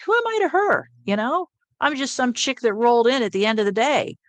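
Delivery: high, loud disbelief; incredulous. Retold or imitated. Telling the angel she can't believe the nurse's grief, incredulous. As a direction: squeak out how absurd it seems.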